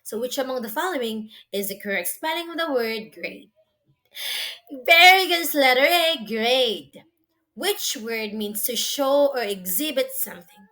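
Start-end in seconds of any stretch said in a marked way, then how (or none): 4.9-7.0 excited praise. Real, not acted. Praising a correct answer.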